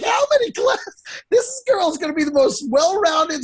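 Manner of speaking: high-pitched incredulous quote; mock astonishment. Co-host teasing dad about his daughter's many classes, mock astonished. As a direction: voice shoots way up in comic disbelief.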